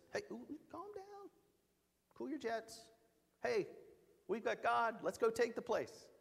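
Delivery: a hint of a bold leader saying cool it; Caleb calming them.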